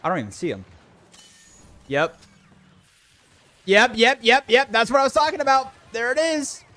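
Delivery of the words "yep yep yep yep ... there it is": high, loud and fast celebrating; excited cheering. Real, not acted. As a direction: cheer the win, talking fast. Success in game.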